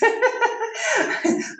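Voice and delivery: short high embarrassed giggle; bashful high laugh. Real, not acted. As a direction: let a high laugh slip out. Laughs after young ladies.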